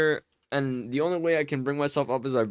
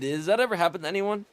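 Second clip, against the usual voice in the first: ordinary talk, nothing marked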